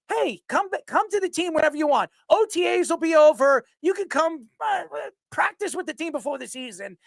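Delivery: lightly voiced easygoing coach inviting him in; imitating Tomlin. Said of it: "Mike Tomlin probably told everybody".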